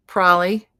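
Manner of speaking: loud, squashed two-syllable "prolly" for Probably; mispronounced probably. Shown as a demonstration. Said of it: "the forty most commonly mispronounced".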